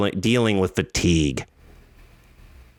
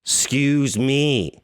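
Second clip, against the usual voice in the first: normal talking voice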